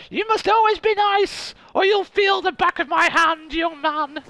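high, strict scolding mother; stern mother voice. Retold or imitated. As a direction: wag a finger like a strict mom. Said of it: "She was like".

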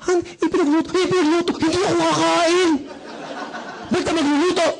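high-pitched, hammed-up tantrum; irritated snapping at spouse. Angry husband refusing the wife's cooking, irritated. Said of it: "dinamay mo".

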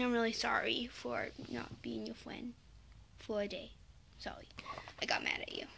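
hushed, sheepish sorry; whispered apology. Real, not acted. Whispered sorry to Alyssa on camera. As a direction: whisper it like a secret.

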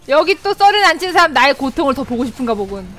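ordinary talk

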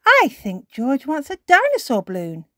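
friendly shop lady, lightly voiced; lightly voiced Miss Rabbit. A narrator's reading. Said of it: "says Miss Rabbit".

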